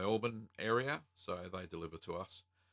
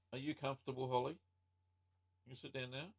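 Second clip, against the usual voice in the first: talking to the dog; 6 semitones higher, faster than usual. Real, not acted. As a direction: gently settle the dog down. Talking to her dog mid-stream.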